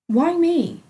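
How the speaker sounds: loud, high demand. A narrator's reading. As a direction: press her for an answer. Angry patient confronting doctor.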